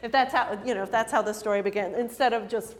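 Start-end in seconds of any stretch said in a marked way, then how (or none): none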